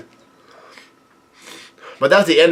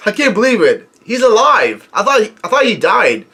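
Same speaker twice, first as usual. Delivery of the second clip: pitched up, can't believe he's alive; stunned disbelief; 7 semitones higher than usual. Real, not acted. Reader shocked the dead commander is back, stunned. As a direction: gasp it out like a shocked fan.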